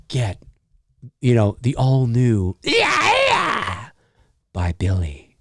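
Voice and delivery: low, slow, sultry ad voice; sensual cologne commercial. Pretend. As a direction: purr it like a perfume ad. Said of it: "A very sensual, soft sounding cologne commercial".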